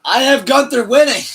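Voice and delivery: shouted over him, high and loud; loud insistence. Real, not acted. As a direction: shout it to win the argument. Insisting loudly over co-host.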